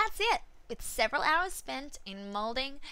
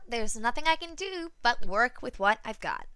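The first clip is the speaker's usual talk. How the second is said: ordinary delivery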